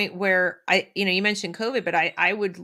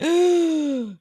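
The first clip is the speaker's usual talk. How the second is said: higher, louder hammed-up gasp of shock; mock gasp; 10 semitones higher, 5 dB louder than usual. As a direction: gasp like you just discovered something. Parents waking up, mock shock.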